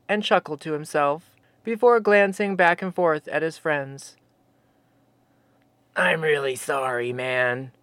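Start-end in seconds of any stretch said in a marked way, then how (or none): 6.0-7.7 low, soft, sheepish apology. A narrator's reading. Sasquatch apologizing to startled Jeremy, sheepish and low. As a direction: apologize like a shy giant. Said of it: "Fosby said sheepishly to Jeremy".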